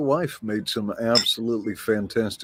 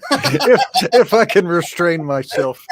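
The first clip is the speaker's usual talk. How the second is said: higher, louder, talking through laughter; laughing; 7 semitones higher, 8 dB louder than usual. Real, not acted. Guest promising not to mock the host, laughing.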